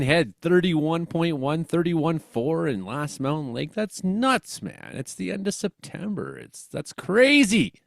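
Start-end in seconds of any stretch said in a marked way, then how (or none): none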